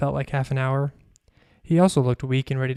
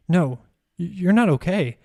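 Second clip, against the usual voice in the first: stammered, higher note of alarm; mildly rattled, pitch rising; shuddering alarm; 4 semitones higher, 3 dB louder than usual. A narrator's reading. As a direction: shudder and stumble over the first words. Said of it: "Patrice shuddered".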